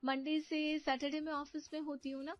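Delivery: put-on high, sweet young woman; cheerful young niece. Young niece chats with aunt on the phone, cheerful. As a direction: chat happily with your aunt, light and high.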